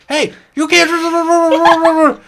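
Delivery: high, very slow, muffled shout from inside a pouch; muffled cry. Acted. Oyster protesting from inside the pouch, muffled. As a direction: yell through the fabric of a bag.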